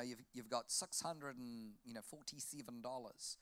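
softly imitated Kiwi bank teller, "six" as "sux"; Kiwi accent. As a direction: mimic the young lady's Kiwi vowels. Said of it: "this girl had a Kiwi accent".

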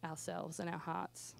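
soft breathy prayer whisper. Real, not acted.

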